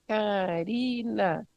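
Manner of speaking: slow, stamped syllables of Karina; name stamped by syllable. Shown as a demonstration. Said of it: "Vou bater o pé". ("Karina" bem devagar, batendo cada sílaba)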